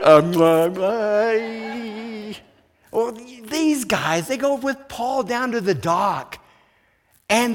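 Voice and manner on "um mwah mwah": awkward kisses back. Retold or imitated. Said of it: "And I'm going".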